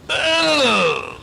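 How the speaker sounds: high, loud "Ugh" of disgust; boy groaning at a hot frog dinner. Acted. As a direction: groan as if tasting something awful. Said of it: "and he went".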